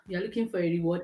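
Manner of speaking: ordinary talk, nothing marked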